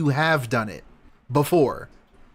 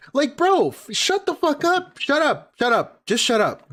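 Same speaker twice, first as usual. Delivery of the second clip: angry yelling; 10 semitones higher than usual. Real, not acted. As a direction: yell him down, shut up. Ranting at DSP's clip.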